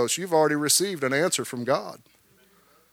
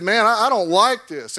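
raised, louder upset outburst; king rips his clothes in dismay, voice rising and louder; 6 semitones higher, 4 dB louder than usual. Acted. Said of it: "and it makes him mad".

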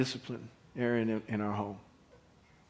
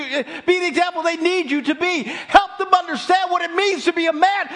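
pleading wife; 13 dB louder than usual. Acted. Zipporah begs Moses to act.

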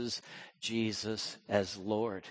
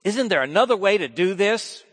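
raised, loud pleading question; pleading Jesus; 9 semitones higher, 11 dB louder than usual. Acted. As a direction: plead for another way. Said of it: "the old temptation voiced".

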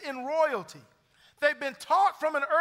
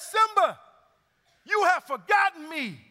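higher and louder, a booming kingly father calling his son; Mufasa voice; 6 semitones higher, 6 dB louder than usual. Acted. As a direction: boom it out like the lion king's ghost. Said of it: "the only voice that could be Mufasa".

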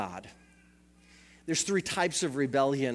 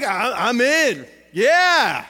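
cocky acceptance; 7 semitones higher, 11 dB louder, much slower than usual. Retold or imitated. Defiant brother accepting punishment.

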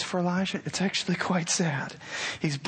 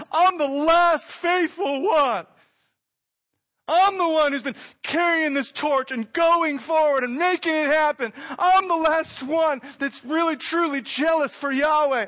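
self-pitying shout; 10 semitones higher, 6 dB louder than usual. Acted. Preacher voicing Elijah complaining to God, shouting high. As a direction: shout your lonely grievance. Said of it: "he cries out".

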